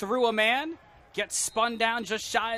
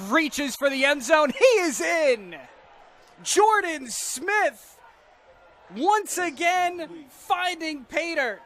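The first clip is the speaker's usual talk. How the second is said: rising, excited touchdown call, much louder; 6 semitones higher, 6 dB louder than usual. Real, not acted. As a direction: build to a roaring touchdown call. Touchdown call by play-by-play announcer.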